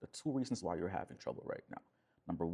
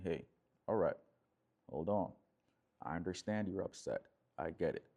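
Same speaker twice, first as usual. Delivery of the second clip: lower, measured reassurance; relaxed, calm; 4 semitones lower than usual. Shown as a demonstration. Man calmly setting boundaries while upset, relaxed. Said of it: "you're relaxed and saying".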